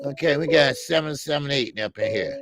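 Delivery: ordinary delivery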